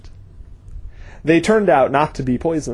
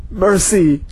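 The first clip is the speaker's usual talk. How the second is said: gasped; 5 semitones higher than usual. Acted. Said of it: "gasped Wayne Mail".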